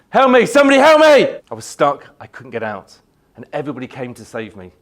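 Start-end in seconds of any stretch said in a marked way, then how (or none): 0.1-1.4 high, loud shouts for rescue; urgent acted yell for help; re-enacted cry for help. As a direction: call out as if trapped in a wreck.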